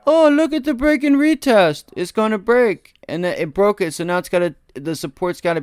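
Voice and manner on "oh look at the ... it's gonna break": much higher, loud, squeaky mocking trader voice; mocking an overeager trader. Streamer parodies viewers shorting the retest, squeaky and loud. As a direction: squeal it like a trader who thinks he's found the easy trade. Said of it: "where I told you".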